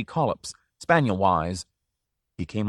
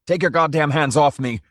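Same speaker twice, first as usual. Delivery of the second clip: loud outraged shout, higher; 6 semitones higher, 5 dB louder than usual. A narrator's reading. Drioli shouting at the owner's grip, loud. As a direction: roar it at the man pushing you. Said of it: "Drioli shouted".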